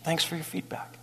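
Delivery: soft, barely voiced calm thanks; polite thanks. Shown as a demonstration. Pastor showing the calm reply to an insult, soft. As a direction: swallow the anger and thank them softly. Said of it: "you just go".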